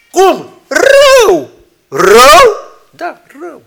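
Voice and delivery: high, loud mock outburst; preacher acting out a shocked exchange; shouted exchange. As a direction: fling the word rău.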